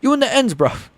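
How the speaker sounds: hammed-up London roadman accent. Streamer playing a roadman for laughs, UK accent. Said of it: "I just like doing the U K accent".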